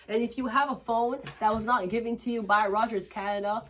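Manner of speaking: hammed-up commercial pitch; mock ad read. Reader breaks into a fake Rogers Canada ad, hammed-up pitch. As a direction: sell the phone plan like a fake sponsor.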